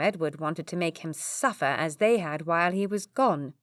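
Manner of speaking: plain reading voice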